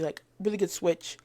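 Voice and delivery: usual speaking voice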